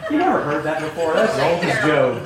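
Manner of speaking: amused ribbing, half laughing; teasing laugh. Real, not acted. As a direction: tease the class through a chuckle. Teasing class after bad joke.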